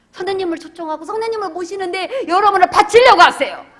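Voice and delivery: shouted exhortation. Real, not acted. Pastor calling the congregation to give themselves, shouting. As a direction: cry it out to the whole church.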